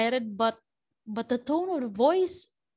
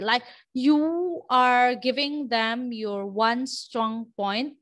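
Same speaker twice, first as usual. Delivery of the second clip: ordinary delivery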